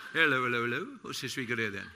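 a light, laughing copper's greeting; a hint of a British bobby; jokey policeman. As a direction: play the beat bobby for laughs. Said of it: "I look like the world for a policeman".

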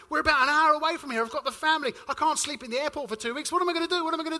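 frantic tourist stuck at the airport desk; panic mode. Retold or imitated. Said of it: "she saw me go into panic mode".

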